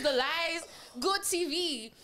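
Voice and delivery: usual speaking voice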